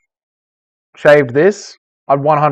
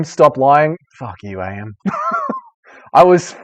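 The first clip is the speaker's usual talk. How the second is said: ordinary talk, nothing marked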